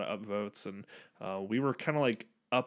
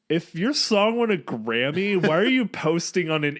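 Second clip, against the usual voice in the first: incredulous commenter; 6 semitones higher, 7 dB louder than usual. Retold or imitated. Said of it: "someone comments and is like".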